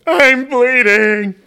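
put-on high, loud, drawn-out whine of a crying little girl; mock crying daughter. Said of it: "And she's crying".

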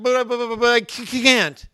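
loud, high sputter on "But" and "can't"; hammed-up tongue-tied objection, bursting out on "can't"; stuttering protest. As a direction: stammer "But, but, but", then stick on the c of "can't". Acts out stuttering Moses objecting.

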